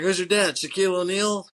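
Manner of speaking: retold scoffing question; mock incredulous. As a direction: needle the kid with mock disbelief. Retold sarcastic jab at kid.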